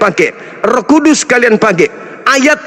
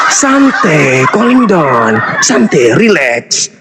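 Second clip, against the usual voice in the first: mock soothing; 7 semitones lower, much slower than usual. Mocking opponent who got angry.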